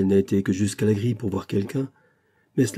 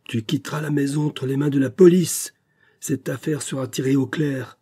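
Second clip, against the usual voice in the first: stern threat; 5 semitones higher, 3 dB louder than usual. A narrator's reading. Father threatens police.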